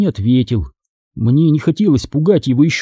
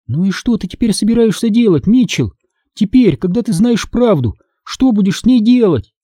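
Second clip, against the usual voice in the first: ordinary delivery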